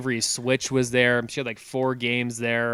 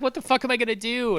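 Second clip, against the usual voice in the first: much higher, desperate question; retold despair; 11 semitones higher than usual. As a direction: cry it out at a loss. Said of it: "So I'm like".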